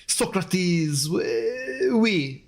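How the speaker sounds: hammed-up French accent, drawn-out names; mock French accent. Trying Greek names on request, mock French accent. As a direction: put on a comic French accent. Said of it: "Try those names with a French accent".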